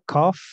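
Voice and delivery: "cough" said as "kof" with a long F at the end. Shown as a demonstration. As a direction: stretch the F in "cough". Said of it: "La G y la H suena como una F, larguita".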